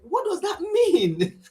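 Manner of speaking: high, slow baffled question; mock bafflement. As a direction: act out being puzzled by young people's slang. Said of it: "I'm like".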